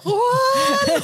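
high, loud yell for her husband; panicked call. Retold or imitated. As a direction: scream his name in panic. Panics, calls husband for help. (panisk rop på mannen, høyt og lyst)

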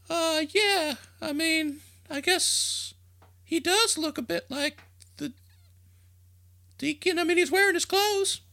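put-on high, thin old lady voice; old woman. Old woman telling the crowd the deacon has been here, high. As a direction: fuss like a doubtful old churchgoer.

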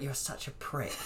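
hissed through clenched teeth, quiet; through gritted teeth. Retold or imitated. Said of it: "when you're saying it through gritted teeth".

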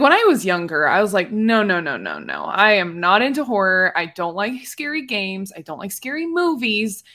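normal talking voice

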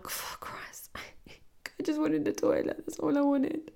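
slow, high, whispered mock plea; helpless hushed whine, played for laughs; whispered exasperation. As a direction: squeak it out under your breath. Retold exasperated plea after being scolded.

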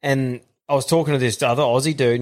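ordinary talk, nothing marked

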